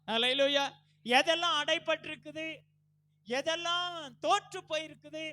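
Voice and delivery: loud, high pulpit cry; full-force preaching. Real, not acted. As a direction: preach it out with each phrase driven hard.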